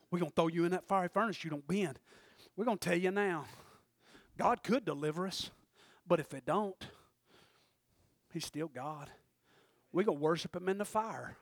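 soft low, quick at the start; hushed retelling. Retold or imitated. Preacher voicing the king and the three men, hushed. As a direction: say it softly, almost under the breath. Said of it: "retelling the three men quietly".

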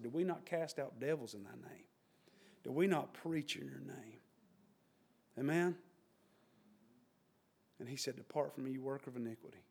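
hushed. Acted. Bible scene quoted low.

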